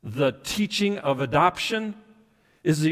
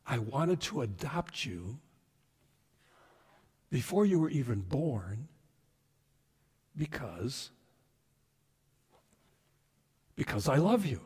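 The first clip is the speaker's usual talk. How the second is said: hushed, with long tender pauses; tender voice of God; 2 semitones lower, 8 dB quieter than usual. Acted. God tells his people of his love, softly and tenderly. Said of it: "So what is God saying here?".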